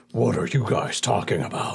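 second stab at a half-orc, lightly voiced; a hint of half-orc. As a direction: put on a modest orc character voice. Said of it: "I was trying to do some".

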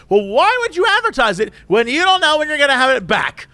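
high, demanding complaining customer; lightly voiced entitled Karen complaining; a hint of Karen. Customer complaining at drive-through window, mock demanding. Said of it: "she's going full Karen".

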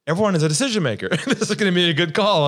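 gushing over a dream call; excited. Retold or imitated. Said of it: "I was pretty excited".

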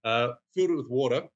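ordinary talk

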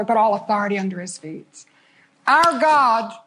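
ordinary delivery

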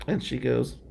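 ordinary talk

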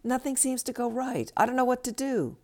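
high-pitched, put-on lament; complaining friend. Friend on the phone with nothing going right, acted high lament. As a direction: wail mildly like a helpless friend. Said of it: "Your friend continues".

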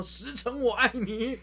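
slow, high yelled move name; attack name shout. Retold or imitated. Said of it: "又打过来说什么".

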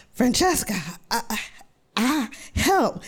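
high, halting plea; pained high call to her friend; weak cry for help. Retold or imitated. Calling friend for help in pain.

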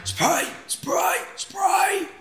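high, strained calls of spray, spray; gasping plea for the spray; begging for the heart spray; desperate cry. Retold or imitated. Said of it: "I was going".

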